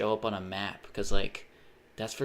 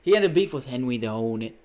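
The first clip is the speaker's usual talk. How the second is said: Roy Hodgson; 6 dB louder than usual. Retold or imitated. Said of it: "Roy Hodgson".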